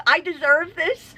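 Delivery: smug self-reward. Retold or imitated. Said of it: "I'm like".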